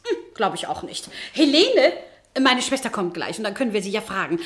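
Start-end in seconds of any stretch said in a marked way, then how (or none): 1.3-1.9 loud, higher call of a name. Acted. Landlady calling her sister from the next room, loud and higher.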